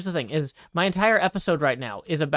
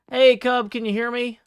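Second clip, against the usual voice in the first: calling out; 6 semitones higher, 5 dB louder than usual. Real, not acted. Calling out to distant friend.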